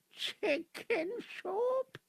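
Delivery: stretched, high-pitched "Chicken soup?"; incredulous. Acted. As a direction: drag out the disbelief, way up high. Patient repeats in disbelief.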